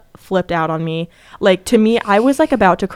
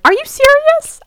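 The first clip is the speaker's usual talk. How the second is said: very high squeaky disbelief; sky-high retold plea; acted dismay; 4 dB louder than usual. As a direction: squeal the question in mock panic. Acts out own panicked plea.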